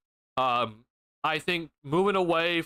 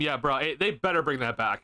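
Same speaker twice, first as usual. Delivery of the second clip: usual speaking voice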